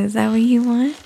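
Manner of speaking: ordinary talk, nothing marked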